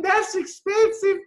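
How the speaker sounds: high, slow, pointed retort; high mock surprise. Coach retells her retort to a client, high and slow. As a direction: throw it back high and slow. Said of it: "I said".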